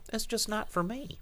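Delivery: high, soft, overly polite voice; mock non-confrontational. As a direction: imitate a meek, PC brush-off. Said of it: "not confrontational".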